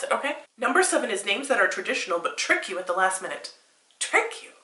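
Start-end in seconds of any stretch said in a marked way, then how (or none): 4.0-4.5 incredulous whisper. Real, not acted. Reading the article, whispers in disbelief. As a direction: breathe it out, baffled.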